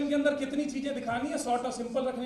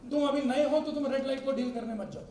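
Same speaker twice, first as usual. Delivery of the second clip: normal talking voice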